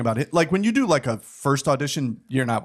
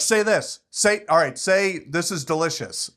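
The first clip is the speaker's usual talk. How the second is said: yelling lines; 6 semitones higher than usual. Retold or imitated. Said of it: "start yelling lines at me".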